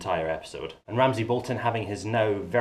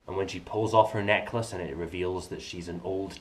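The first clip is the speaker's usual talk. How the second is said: ordinary talk, nothing marked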